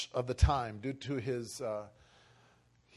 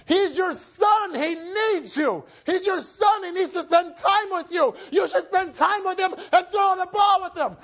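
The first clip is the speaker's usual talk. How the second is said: mother yelling; 8 dB louder than usual. Retold or imitated. Mom fighting dad over their son, yelling. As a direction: shout each demand at full force. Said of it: "fighting and yelling at each other".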